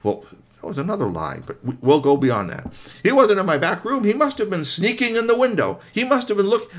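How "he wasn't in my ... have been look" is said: high, loud protesting; flustered, high-pitched denial; frantic excuses. Acted. Witch making up frantic excuses.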